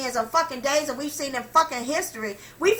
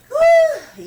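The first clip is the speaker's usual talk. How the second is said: high, loud "whew" of release; long high whew after a rant; big exhaled whew; 11 dB louder than usual. Real, not acted. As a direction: blow off steam with a whooping whew. Blowing off steam after a rant.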